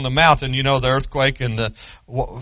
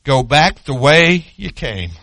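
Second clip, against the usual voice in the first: slower, measured voice of God; God's steady word to the prophet, unhurried; God speaking; 8 dB louder, slower than usual. Acted. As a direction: give the command calmly, as God to his prophet. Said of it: "He says".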